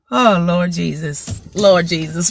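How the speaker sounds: low, slow, sighing appeal; exasperated sigh. Real, not acted. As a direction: groan it out to the heavens. Exasperated aside.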